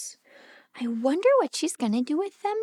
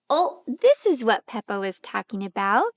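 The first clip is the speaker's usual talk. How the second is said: ordinary delivery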